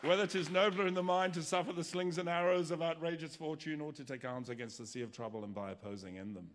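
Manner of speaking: Shakespeare recital. Pretend. Preacher hamming up Hamlet, grand theatrical recital. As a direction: roll it out like an old stage actor. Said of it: "I could even do Shakespeare".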